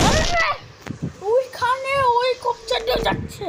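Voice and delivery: high panicked wail; panicked whine. Real, not acted. Character dying, panicked.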